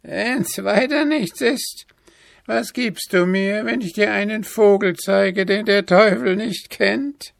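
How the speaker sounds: hohe, langsame, verschlagene Altweiberstimme; listige Alte. A narrator's reading. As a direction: lockend ein Angebot machen. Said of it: "lachte das alte Weib". (hohe, listige Hexenstimme)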